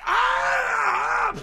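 long, high sustained yell; imitated scream. Radio host imitating the screaming dubbed onto a block, drawn-out mock scream. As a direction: mimic a lineman screaming as he charges. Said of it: "where he's screaming".

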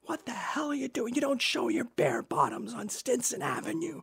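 high, shrill-voiced angry mother; an outraged neighbourhood mum scolding a mooning kid; mock screaming mother. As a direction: play the outraged mum in falsetto. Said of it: "she started screaming at me".

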